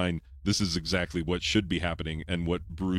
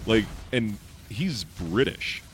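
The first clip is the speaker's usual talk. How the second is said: ordinary talk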